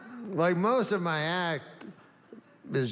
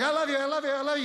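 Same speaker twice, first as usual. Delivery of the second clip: high, gushing puppy voice, much higher than his own; happy dog voice; 9 semitones higher, much faster than usual. Retold or imitated. Comic imitating his adoring dog, gushing high. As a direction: pour out love like an eager dog. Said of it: "and he's like".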